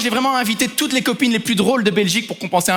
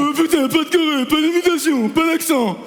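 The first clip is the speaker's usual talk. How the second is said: higher mock echo of partner; mocking mimicry; 3 semitones higher than usual. Host parrots partner's list of bans, mocking mimicry. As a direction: parrot your partner's complaint back. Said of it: "Tu viens de m'imiter?".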